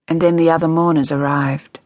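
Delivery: plain reading voice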